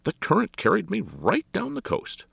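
high, loud retort; sharp retort. A narrator's reading. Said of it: "Alexander retorted".

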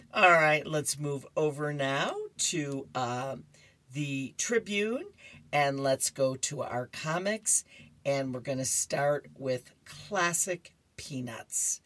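normal talking voice

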